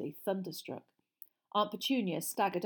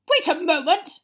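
high, loud, angry command; bellow; 10 semitones higher, 13 dB louder than usual. Acted. Uncle shouting at the fire, angry bellow. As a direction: bellow a halt. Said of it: "he bellowed at the fire".

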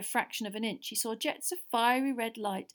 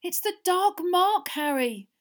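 high, loud, drawn-out dread; terrified moan; 9 semitones higher, 8 dB louder, slower than usual. A narrator's reading. Hermione pulling Harry away, terrified moan. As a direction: wail it slowly in fear. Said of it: "Hermione moaned".

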